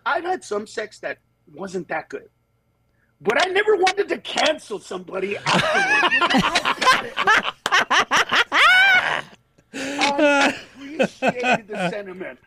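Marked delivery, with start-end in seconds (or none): 3.2-12.4 laughing through the words. Real, not acted. Comedian laughing at his own sex joke, high and giggly. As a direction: crack up while saying it.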